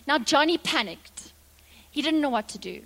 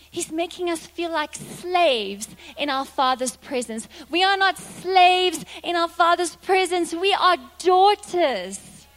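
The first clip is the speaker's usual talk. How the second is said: rising, louder sermon climax; preaching; 6 semitones higher, 6 dB louder than usual. Real, not acted. Preacher rallying the congregation, full-force preaching. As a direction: drive the point home to the congregation.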